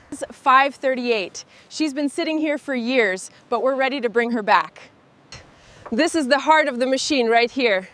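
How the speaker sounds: ordinary talk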